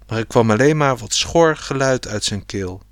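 ordinary delivery, nothing marked

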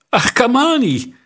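high, bright calling of a name; glad cry. A narrator's reading. Queen calling out to her nephew with delight. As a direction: call his name out in delight. Queen greets the boy gladly.

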